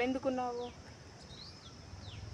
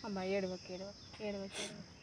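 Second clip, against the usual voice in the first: soft, hushed comforting; quiet reassurance, fading softer; gentle consoling; 11 dB quieter than usual. Acted. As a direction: soothe someone who is crying, very softly. Comforting a crying old woman.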